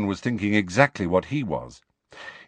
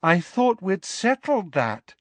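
maddeningly calm; 11 semitones higher than usual. A narrator's reading. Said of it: "said Luna maddeningly".

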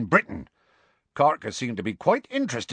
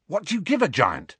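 eager; 8 semitones higher than usual. A narrator's reading. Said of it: "asked Ron eagerly".

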